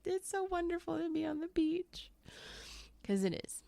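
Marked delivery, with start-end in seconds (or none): 0.0-2.1 pitched-up fake brightness; high voice, covering tears with cheer; high mock cheer. Crying on the beach, telling passersby, high mock cheer. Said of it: "And I'm like".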